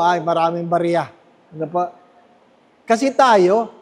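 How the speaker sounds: normal talking voice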